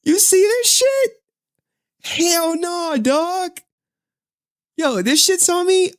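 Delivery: high, strained cries of disgust; freaked out. Real, not acted. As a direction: squeal in real horror at the spider. Freaked out by spider clip.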